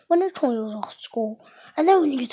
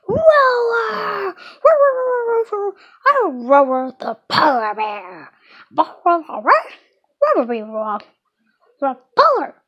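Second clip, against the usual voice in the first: loud, high playful roars; polar bear roar; 7 semitones higher, 6 dB louder than usual. Acted. Kid roaring as the stuffed polar bear. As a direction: roar like a toy bear coming alive. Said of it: "Stop talking like that".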